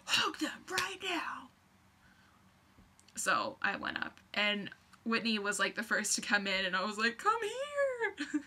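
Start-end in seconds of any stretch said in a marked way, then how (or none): 0.0-1.5 slow whispered command; whispered order. Retold or imitated. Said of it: "I sound like a drill sergeant". 7.2-8.4 high squealing laughing call; excited squeal. Retold or imitated. Hugging friend, laughing.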